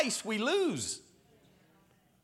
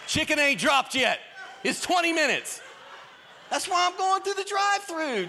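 higher, louder mock exasperation; mock drive-thru complaint; 9 semitones higher, 3 dB louder than usual. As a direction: ham up the annoyed drive-thru customer. Mocking slow Popeye's drive-thru.

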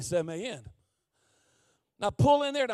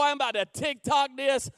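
ordinary talk

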